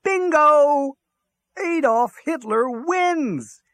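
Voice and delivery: high, loud cry of victory, drawn out; triumphant shout. A narrator's reading. Hitler winning at bingo in the bunker, triumphant shout. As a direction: shout it like a jubilant winner. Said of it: "it is Der Fuhrer who shouts".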